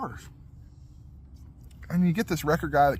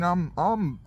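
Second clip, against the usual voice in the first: normal talking voice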